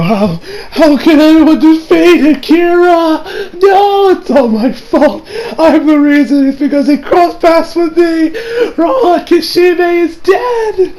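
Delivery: high, slow, wailing mock sobs; tearful impression of Hayato. Imitating the guilt-stricken boy in the rain, anguished wail. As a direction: cry it out in hammed-up despair. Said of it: "I'm honestly not doing this nowhere near as much".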